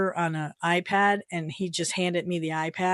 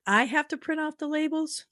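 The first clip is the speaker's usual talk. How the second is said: echoing the clerk, much higher and disbelieving; incredulous echo; 8 semitones higher than usual. Retold or imitated. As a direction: repeat her words back in disbelief. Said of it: "I'm like".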